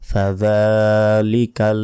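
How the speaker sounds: ফাযালিকাল said slowly, the ল stretched for a second; drawn-out demo. Shown as a demonstration. Teacher drills students on one-second elongation. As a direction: hold the zal sound of ফাযালিকাল. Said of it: "জাল এক second টেনে পড়তে হবে".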